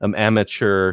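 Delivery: ordinary talk, nothing marked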